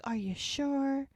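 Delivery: high, drawn-out checking question; imitated lingering concern; doubtful concern. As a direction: stretch it out, unconvinced and caring. Retold woman doubts his answer.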